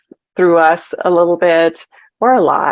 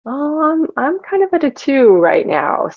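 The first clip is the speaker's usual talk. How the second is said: higher-pitched musing to oneself; acted self-check; 9 semitones higher than usual. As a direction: voice a pondering self-assessment, pitched up. Acting out an honest self-check.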